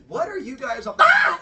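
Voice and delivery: high question breaking into shriek; startled scream. Retold or imitated. Storyteller as Athena spotting the hanging girl, startled scream. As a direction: cut yourself off with a shocked scream.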